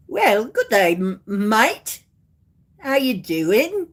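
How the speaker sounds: hammed-up Aussie greeting; playful mock-Australian hello; Australian accent. Shown as a demonstration. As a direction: show off a put-on Australian accent. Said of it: "I have more and better accents".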